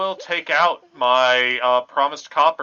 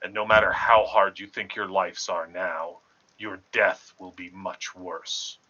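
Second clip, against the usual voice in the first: ominous warning; 5 semitones lower, 5 dB quieter than usual. Acted. Warning the children about the ferryman, low and ominous.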